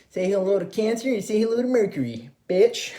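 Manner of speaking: high, cheeky send-off, laughing into it; a gleeful, mocking farewell; sassy taunt. Real, not acted. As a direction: toss it at the cheater with attitude.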